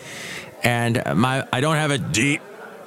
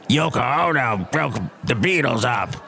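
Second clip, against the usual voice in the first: mocking old men.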